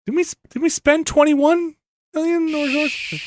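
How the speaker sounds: high, small mock-meek voice; timid questioner. Nobody dares ask this in the meeting, timid questioner.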